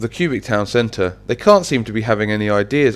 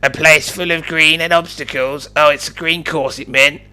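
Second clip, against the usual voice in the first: high put-on character voice; high character voice, working it out; Sir Pixel; 6 semitones higher than usual. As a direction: think aloud in a pitched-up, odd voice. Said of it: "Sir Pixel exclaimed".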